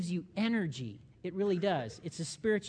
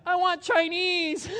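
high, loud, slow whiny demand; the secret craving finally bursts out; mock inner whine; 11 semitones higher, 8 dB louder, slower than usual. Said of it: "And on the inside".

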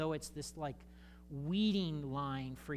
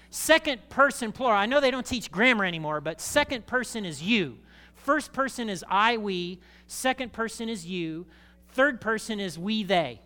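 grammar lesson; 4 semitones higher, 8 dB louder than usual. Real, not acted. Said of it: "teaching grammar to congregation".

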